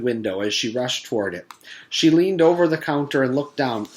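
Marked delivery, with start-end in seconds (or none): none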